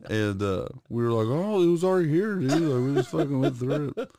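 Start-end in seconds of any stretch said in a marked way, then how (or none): 1.3-4.1 higher, fast, faux-innocent excuse; mock innocence. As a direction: play dumb about the hole. Feigning innocence.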